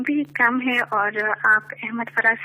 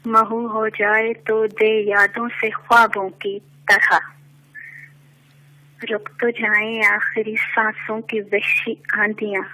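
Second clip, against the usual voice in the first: measured poetic recitation, lower; stately nazm recitation; 4 semitones lower, faster than usual. Acted. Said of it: "ایک نظم پیش کرنا چاہوں گی".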